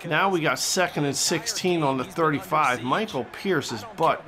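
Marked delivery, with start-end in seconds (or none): none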